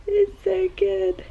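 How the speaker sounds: high, slow tearful squeak; real overcome, high-pitched delight; emotional joy. Real, not acted. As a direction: squeeze it out through happy tears. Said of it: "I don't know why I'm getting emotional".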